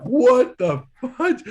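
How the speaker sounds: loud, high, drawn-out disbelief; shocked outcry. Retold or imitated. Roommates react to a swarm of fruit flies, loud shocked outcry. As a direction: cry out slowly in mock horror.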